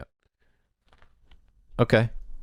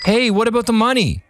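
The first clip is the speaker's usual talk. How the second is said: calling out; 4 dB louder than usual. Acted. Calling after leaving man.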